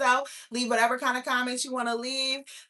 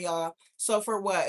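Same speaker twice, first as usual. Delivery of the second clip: ordinary talk, nothing marked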